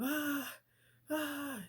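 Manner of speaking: loud, very high screams; short shrieks, as if waking terrified; acted panicked scream. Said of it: "waking up screaming".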